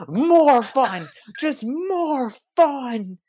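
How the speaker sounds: high, drawn-out mock rallying cry; mock screaming. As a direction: stretch it out in a hammy high holler. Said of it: "he just starts screaming out of nowhere".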